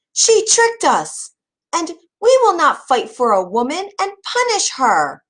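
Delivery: rally the crowd against her, pitched high; angry calling out. A narrator's reading. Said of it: "Some called out".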